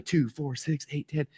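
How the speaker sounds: softly counting to himself; tally it quietly, privately; counting under breath. Retold or imitated. Young student doing the math at the back, quiet counting.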